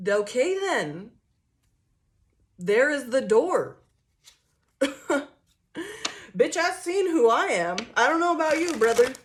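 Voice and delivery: loud, high, slow "There is the door"; mock defiant comeback. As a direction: dare them to walk out. Defiant comeback to bluffing partner.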